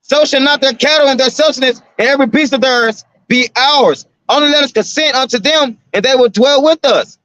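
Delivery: preacher reading King James aloud; scripture reading. Acted.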